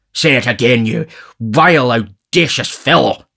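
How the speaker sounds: scandalised official dressing someone down; indignant beadle. A narrator's reading. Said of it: "in a strong indignation".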